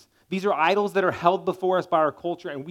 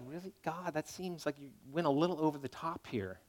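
much softer, a hushed grumble aimed at God; quiet mock protest to God; mock complaint; 9 dB quieter than usual. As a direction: murmur it like a private objection. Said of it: "you're like".

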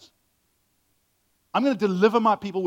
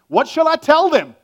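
high, loud pleading "what shall I tell them"; anxious question; 9 semitones higher, 9 dB louder than usual. Retold or imitated. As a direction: plead it up high like worried Moses. Moses pleading with God.

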